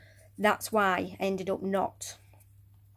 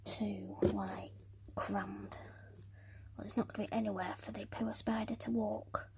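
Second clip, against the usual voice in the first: whispering; 10 dB quieter, slower than usual. Real, not acted. Muttering while arranging.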